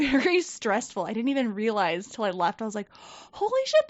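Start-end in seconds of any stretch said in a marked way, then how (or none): 3.3-3.9 sudden high squeal of disbelief; a high, startled holy-shit moment; shocked realization. Retold or imitated. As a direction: blurt it out stunned, voice jumping way up. Realizing workplace stress afterward.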